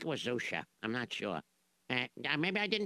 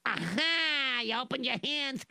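triumphant aha; 9 semitones higher, 3 dB louder, slower than usual. Acted. Catching the trick.

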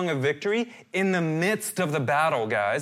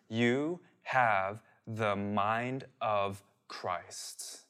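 very slow and soft, word by word; hushed, solemn, long pauses between words; slow, deliberate emphasis; 4 semitones lower, 5 dB quieter, much slower than usual. Real, not acted. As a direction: let each word land separately, quietly. Driving home a scriptural truth.

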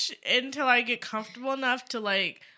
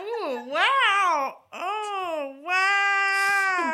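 mock amazement; 7 semitones higher, 4 dB louder, much slower than usual.

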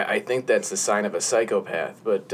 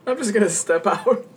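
laughing; 11 semitones higher than usual. Real, not acted. Still giggly.

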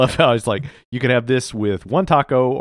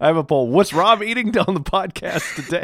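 mock poll announcer; 7 semitones higher, 3 dB louder than usual.

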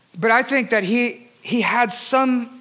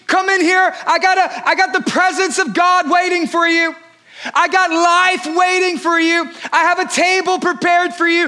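high, loud inviting call to passers-by; 7 semitones higher, 3 dB louder than usual. Acted. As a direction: call out across the street to everyone. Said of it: "Jesus is the one who is calling out".

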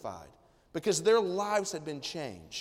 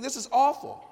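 higher and louder, self-righteous disgust; judging the nine lepers from a high horse, loud and high; mock outrage; 8 semitones higher, 7 dB louder than usual. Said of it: "You think".

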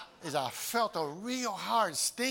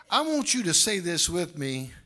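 loud, rising, chanted preaching; full-force preaching; 5 semitones higher, 11 dB louder than usual. Acted. Preacher rallying the congregation, loud chanted preaching. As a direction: build them up and shout it home.

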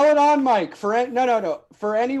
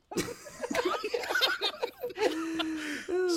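high breathy laugh; 11 semitones higher, 8 dB quieter than usual. Real, not acted. Laughing signing off.

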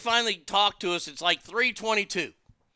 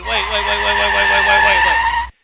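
loud, mounting shout of wait; furious repeated wait, swelling to a yell; 11 dB louder than usual. Retold or imitated. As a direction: cut everyone off, getting louder. Raging interruption in retold argument.

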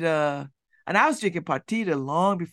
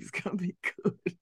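laughing; 6 dB quieter than usual. Real, not acted. Laughing at celebrity brands.

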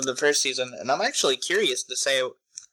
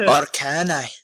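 put-on a quick joking Scottish accent; 3 dB louder than usual. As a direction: suddenly slip into mock Scots. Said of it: "I can't do a Scottish accent".

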